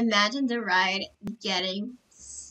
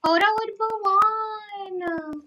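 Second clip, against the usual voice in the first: high, stretched-out squeal of delight; thrilled, lingering cheer; excited call-out; 8 semitones higher, 4 dB louder, slower than usual. Real, not acted. Excited at meeting Mulan in game.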